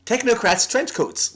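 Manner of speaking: quick "Technocrats, trench coats" said in a row; tongue twister attempt. Shown as a demonstration. Showing viewers the tongue twister, a quick run through. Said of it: "Say it right now".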